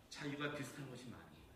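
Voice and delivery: ordinary talk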